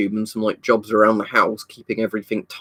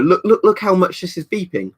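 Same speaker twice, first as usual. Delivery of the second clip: mock know-it-all; 8 semitones higher, 3 dB louder than usual. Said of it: "somebody who thinks they're being clever going".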